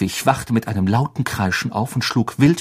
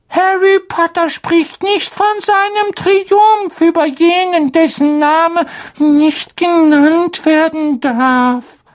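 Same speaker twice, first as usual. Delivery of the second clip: very high, slow, awed elf voice; a reverent little house-elf; squeaky house-elf voice; 5 dB louder, slower than usual. Acted. Dobby the house-elf speaks.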